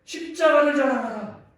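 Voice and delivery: high, very loud exhortation; preaching shout. Real, not acted. Preacher urging the congregation to boast of the cross, shouting. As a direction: proclaim it at full force to the room.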